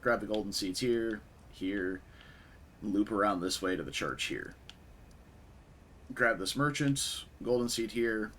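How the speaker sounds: usual speaking voice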